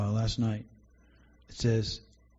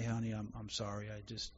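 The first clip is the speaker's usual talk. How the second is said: quiet, fading apology to his wife; quiet, emotional apology to his wife; tearful apology; 3 semitones lower, 12 dB quieter than usual. Retold or imitated. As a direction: say sorry softly, voice dropping away. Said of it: "I'll get emotional about it".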